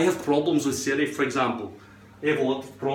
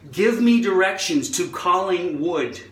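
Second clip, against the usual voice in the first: put-on American accent, emphatic; over-enunciate it in American; 3 semitones higher than usual. Repeating the request to Siri in a put-on American accent. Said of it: "speak with an American accent".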